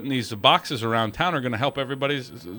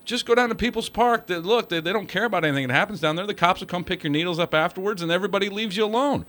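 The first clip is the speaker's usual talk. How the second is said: pitched way up, breezy parody, rushing faster at the end; sarcastic mock invitation; 7 semitones higher than usual. Caller parodying what the city tells drug users, sarcastic high voice. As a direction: send up the inviting message, high and quick.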